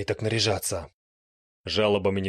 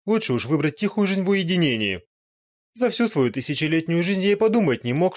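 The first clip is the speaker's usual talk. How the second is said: high, loud apologetic resignation; humbled vampire; 6 semitones higher, 4 dB louder than usual. Acted. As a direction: give up and apologize. Vampire relieved to survive.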